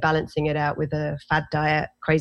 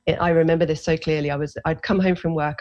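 usual speaking voice

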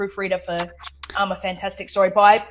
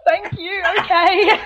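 high, small laughing "thank you, okay"; meek thanks; 11 semitones higher, 4 dB louder, slower than usual. Retold or imitated. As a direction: squeak it out meekly, pitched up. Her meek reply.

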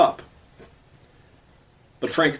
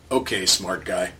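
grudging cop; 6 semitones lower, 4 dB quieter than usual. Retold or imitated. Cop grudgingly concedes to Frank.

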